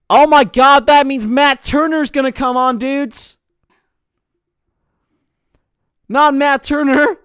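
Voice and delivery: loud, high mock American accent; over-the-top American accent. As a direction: ham up a brash American voice. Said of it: "I just did the accent".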